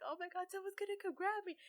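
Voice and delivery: high, quick retold panic; scared. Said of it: "in that moment, I really, like, I got scared".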